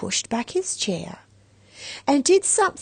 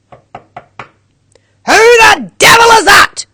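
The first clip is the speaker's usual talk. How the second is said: loud, high, slow bellow; angry shout; 12 semitones higher, 16 dB louder, slower than usual. A narrator's reading. Grumpy old man shouting at a knock on his door. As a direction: roar it through the door. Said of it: "shouted a voice from inside".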